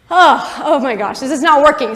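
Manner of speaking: loud groaning frustration, half laughing; mock exasperation at a failing plan; acted exasperation. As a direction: play the thwarted schemer, fed up. [name] exasperated as his plan fails.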